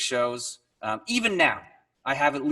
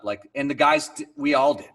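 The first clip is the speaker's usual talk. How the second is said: ordinary talk, nothing marked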